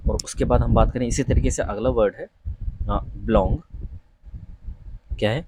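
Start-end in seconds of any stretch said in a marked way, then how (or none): 3.0-3.6 बिलोंग with an open 'aw', as 'blawng'; correct pronunciation. Shown as a demonstration. Teacher introduces the next word, correct pronunciation. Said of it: "कुछ लोग इसे बिलोंग बोल देते हैं".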